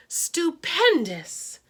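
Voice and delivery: high, very slow stretched praise; awed exclamation. A narrator's reading. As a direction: draw the word out in wonder. Narrator exclaims in delight.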